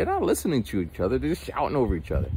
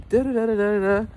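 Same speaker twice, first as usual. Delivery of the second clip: higher mock imitation of her; breezy high mimic of her dismissal; 8 semitones higher, 3 dB louder than usual. Friend waving off critics, lightly voiced high mimic. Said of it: "but she's like".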